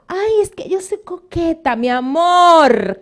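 high, coy voice, stretching mi amor slowly; teasing impression of a flirt; mock flirt. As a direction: play a coquettish woman excusing herself. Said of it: "cuando una mujer dice".

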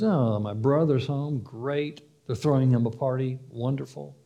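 soft, sullen mock cheer; sulky sarcasm. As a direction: pout through fake delight. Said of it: "He goes and pouts".

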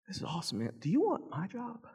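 impressed boss. Retold or imitated. Boss impressed by his work.

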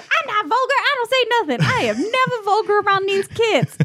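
hammed-up defensive uncle; high, insistent mock protest; mock indignant uncle. Bernie voiced denying vulgarity.

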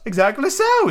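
very high, eccentric reply; odd high-pitched tea host; Hatter character voice. Acted. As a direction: pipe it up theatrically.

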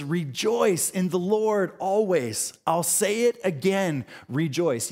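ringing emphatic Rejoice in the Lord; emphatic scripture quote. Acted. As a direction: lean hard on Rejoice, preacher style. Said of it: "likes to repeat things for emphasis".

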